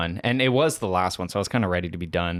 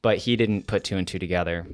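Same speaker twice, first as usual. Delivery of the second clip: normal talking voice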